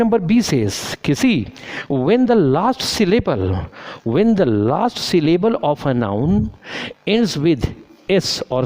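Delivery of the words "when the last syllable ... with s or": slow rule reading. Shown as a demonstration. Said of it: "teacher reads rule slowly".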